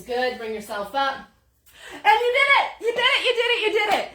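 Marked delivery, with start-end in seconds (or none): 1.9-4.1 high, loud cheer, with a laugh at the end; loud triumphant cheering as class finishes the plank; excited cheering. Real, not acted. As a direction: shout it out like a proud coach. Coach celebrates finishing the plank.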